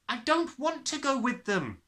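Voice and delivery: put-on high, loud, defiant child; a girl shouting she won't go; sudden shout. A narrator's reading. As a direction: burst out in refusal. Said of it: "Matilda shouted suddenly".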